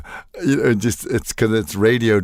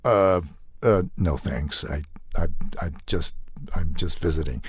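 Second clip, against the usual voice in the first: halting, lower, awkward polite refusal; 3 semitones lower than usual. Acted. Flummoxed assassin turns down a flamboyant stranger, awkwardly. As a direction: stammer it out, flummoxed and hesitant. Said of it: "in a delightfully sort of awkward way".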